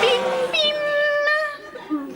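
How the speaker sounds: high sung three-note ding-dong; sung airline chime. Acted. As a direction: sing it like an airport announcement chime.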